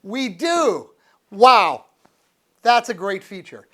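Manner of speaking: delighted loud shout of discovery; a burst of real excitement as the test works; we do, wow, shouted with joy; thrilled. Real, not acted.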